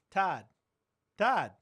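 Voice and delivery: loud sharp call, pitch jumping up; shouting a name. Real, not acted. Host yelling at a cohost mid-story, sharp loud call. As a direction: bark the name across the room. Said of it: "I'm screaming in the microphone".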